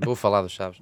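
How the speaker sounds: normal talking voice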